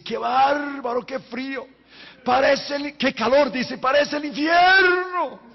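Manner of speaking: high, slow, shouted complaint about the heat; hot man's outcry. Retold or imitated. Mexican man in the sauna shouting about the heat. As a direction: cry out like a man roasting.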